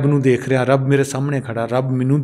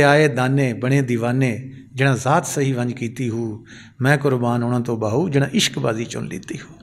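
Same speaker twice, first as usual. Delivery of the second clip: low, measured recital fading softer; Sufi verse recitation; 4 semitones lower, 4 dB quieter than usual. Acted. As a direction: recite the verse deliberately, settling down at the end. (ਧੀਮੀ ਸੁਰ ਵਿੱਚ ਸੂਫ਼ੀ ਕਲਾਮ ਦਾ ਪਾਠ)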